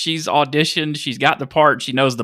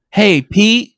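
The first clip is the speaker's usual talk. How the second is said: mock greeting; 6 semitones higher, 5 dB louder than usual. Mocking the goon's greeting.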